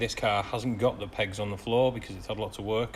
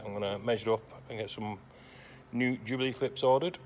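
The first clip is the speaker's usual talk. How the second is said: usual speaking voice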